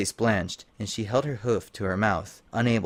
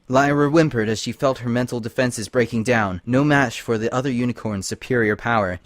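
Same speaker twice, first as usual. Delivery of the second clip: ordinary delivery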